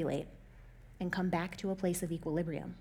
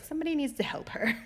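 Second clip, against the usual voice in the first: concerned onlooker; 6 semitones higher, 4 dB quieter than usual. Retold or imitated. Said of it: "they would have been like".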